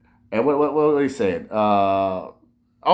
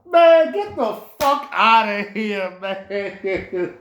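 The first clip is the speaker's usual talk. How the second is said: much higher, slow, drawn-out scoff; scoffing disbelief; 7 semitones higher, 3 dB louder, much slower than usual. Real, not acted. Brushing off [name]'s fake sympathy, slow high scoff. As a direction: drag it out like you can't believe it.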